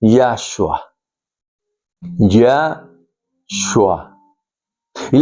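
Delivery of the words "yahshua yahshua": Yahshua said as YAH-shwah; demonstrating his own way of saying Yahshua; pronunciation demo. Shown as a demonstration. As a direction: say Yahshua slowly and clearly, twice. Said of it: "el nombre o la expresión".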